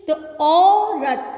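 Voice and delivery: औरत with long drawn औ, slowly; stretched au. Shown as a demonstration. Teacher modelling the long औ of औरत for kids. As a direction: pull out the औ of औरत. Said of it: "अगर हम short में बोलेंगे बिना खींचे".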